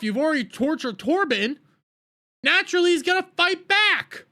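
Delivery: fed-up fan yelling at the screen; exasperated shout. Real, not acted. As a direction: shout it in disbelief at the show. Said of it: "ranting at the episode".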